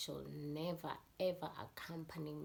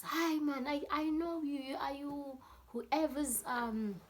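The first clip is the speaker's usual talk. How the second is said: raised, higher voice questioning; imitated shouting dad; 10 semitones higher, 5 dB louder than usual. Dad asking the girls whose child they are, raised voice. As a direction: demand an answer, mock-shouting. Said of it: "their dad is still shouting".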